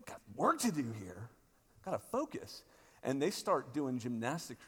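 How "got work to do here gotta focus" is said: a hint of frazzled self; mock stressed muttering. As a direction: mutter like a harried writer. Self as busy stressed writer.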